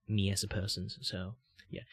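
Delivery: usual speaking voice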